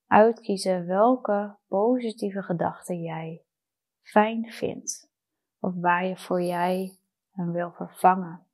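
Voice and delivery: ordinary talk, nothing marked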